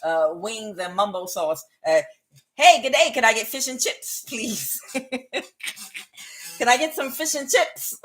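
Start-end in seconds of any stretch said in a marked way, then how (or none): 2.6-4.8 higher, playful mock British; attempted British accent. Out-of-place customer ordering food, attempted British accent. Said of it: "could do a British accent". 6.6-8.0 higher mock British, just playing; hammed-up British accent attempt. Out-of-place customer asking again for food, attempted British accent. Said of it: "could do a British accent".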